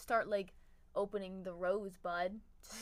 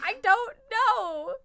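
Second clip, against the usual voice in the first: put-on slow, much higher, louder whiny kid; mock helpless whine; 11 semitones higher, 14 dB louder, much slower than usual. Retelling answering her dad's math question, slow high mock whine. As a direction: drag it out like a stumped, whining kid.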